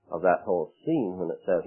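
ordinary delivery

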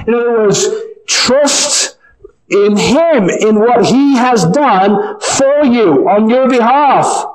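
choked up with real feeling; voice breaking. Real, not acted. Preacher moved to tears urging hearers, voice breaking.

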